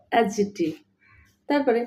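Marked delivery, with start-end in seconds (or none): 0.1-0.7 অ্যাডজেক্টিভ with no D before the J; silent D. Shown as a demonstration. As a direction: say অ্যাডজেক্টিভ as "a-jektiv". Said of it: "এটার উচ্চারণ হবে".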